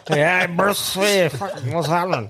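run-together broad Aussie greeting; thick Aussie slang. Retold or imitated. Imitating Cam's slang for laughs, thick slurred Aussie. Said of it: "proper slang Aussie shit".

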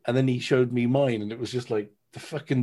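ordinary talk, nothing marked

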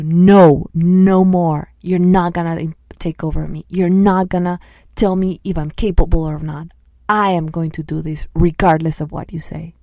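loud, forceful refusal; firm voice refusing to be taken over; firm and strong. Shown as a demonstration. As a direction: stand your ground and push back loudly. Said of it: "get very firm and strong and say".

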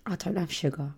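hushed muttered panic; a quiet private oh no; panicked inner voice. Retold or imitated. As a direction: think it in a soft, horrified hush. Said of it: "I'm thinking".

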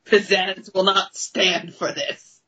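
normal talking voice

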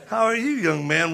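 lightly put-on Iraqi accent. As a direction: greet in a mock Iraqi accent. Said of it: "with an Iraqi accent".

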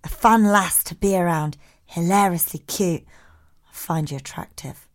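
low, soft, lightly put-on accent; Keith accent. Keith texting compliments to Jemima, in a lightly put-on Keith accent. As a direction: read the texts in a low-key accent. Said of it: "Do it in a Keith accent".

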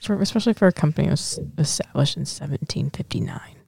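unvoiced whispered aside. Real, not acted. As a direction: whisper it under the breath. Hushed aside about the bottle.

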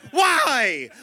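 high-pitched mock child's whine; pestering little kid. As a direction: squeal it like a nagging three-year-old. Three-year-old keeps asking why.

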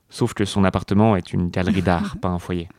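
ordinary delivery

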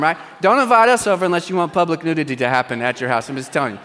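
usual speaking voice

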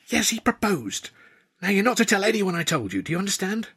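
a hint of an exasperated young woman confiding; Tilly's voice.

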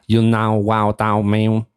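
hammed-up American twang; put-on American accent. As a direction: stretch it out in an exaggerated American accent. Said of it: "see that American accent?".

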